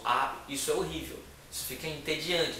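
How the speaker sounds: ordinary talk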